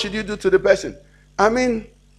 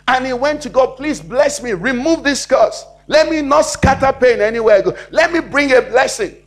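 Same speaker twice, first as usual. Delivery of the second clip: raised, emphatic preacher's voice; full-force preaching; 4 semitones higher, 3 dB louder than usual. Acted. Preacher crying out Jabez's prayer to God, forceful preaching. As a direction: drive each prayer line home, high and strong.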